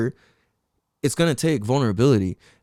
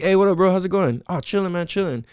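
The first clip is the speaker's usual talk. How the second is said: casual small talk; 6 semitones higher than usual. Retold or imitated. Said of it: "picture this, two dudes talking".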